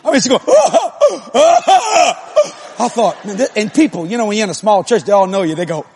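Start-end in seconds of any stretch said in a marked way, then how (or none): 0.4-2.7 high mock wailing sobs; heaving put-on sobs; imitated hard sobbing. Preacher shows how hard his wife sobbed, mock wailing. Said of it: "I ain't never heard her sob that hard".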